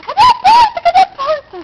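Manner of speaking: high loud panicked yell; retold yelling. Girl retelling her lizard panic to a friend, loud high yell. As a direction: shout it holding the lizard, looking for the door. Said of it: "comecei a gritar".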